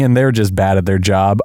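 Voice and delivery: ordinary talk, nothing marked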